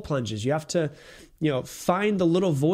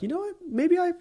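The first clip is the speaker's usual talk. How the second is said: much higher, tiny squeaky inner voice; little inner voice. Retold or imitated. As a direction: play the sly little voice in your head. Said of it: "that little voice comes into your head and you're like".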